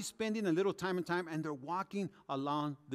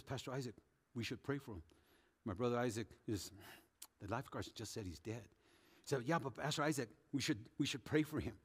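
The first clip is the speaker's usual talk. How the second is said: hushed; 7 semitones lower, 9 dB quieter, faster than usual. Retold or imitated. Convert urging quietly by body.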